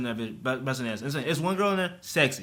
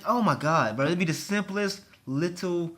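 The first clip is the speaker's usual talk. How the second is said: ordinary delivery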